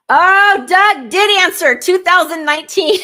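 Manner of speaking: high, loud delighted squeal breaking into laughter; thrilled shriek that dissolves into a laugh; excited squeal. Real, not acted. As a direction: squeal with joy at seeing his name. Spots husband's answer in chat.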